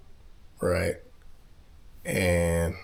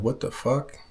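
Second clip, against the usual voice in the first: a higher-pitched retold outburst from dad; retelling a drunk father's angry outburst, pitched up; imitating angry dad; 6 semitones higher than usual. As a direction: throw it out like a shocked, angry father. Drunk dad scolding son.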